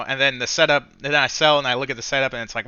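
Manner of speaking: normal talking voice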